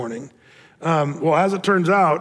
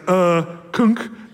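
mock gulp. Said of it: "the oldest guy's like".

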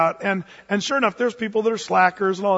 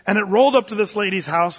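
ordinary talk

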